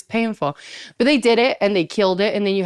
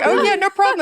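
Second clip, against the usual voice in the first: high, put-on nonchalance; mock cool, overly breezy; acting cool; 12 semitones higher, 3 dB louder than usual. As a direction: play it casual, pitched up high. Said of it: "I'm trying to act cool".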